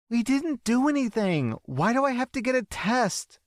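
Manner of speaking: put-on pleading girl. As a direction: beg her not to make her. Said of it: "The girl is pleading".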